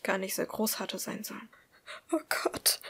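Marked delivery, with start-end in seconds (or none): 2.1-2.8 breathless gasped whisper; gasping. A narrator's reading. Woman staring at her bleeding cut, gasping it out. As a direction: gasp it out, almost voiceless. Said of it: "stieß sie schließlich keuchend hervor".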